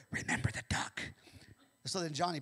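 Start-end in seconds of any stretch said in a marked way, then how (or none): 0.1-1.0 sly threatening whisper. Acted. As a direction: breathe it as a secret threat. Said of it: "And she whispered".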